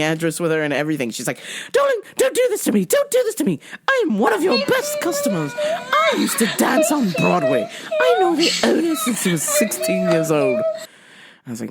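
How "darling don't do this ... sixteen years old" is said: very high, theatrical English protest; hammed-up English diva pleading; phony English accent. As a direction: wail grandly in fake English. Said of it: "phony English accent".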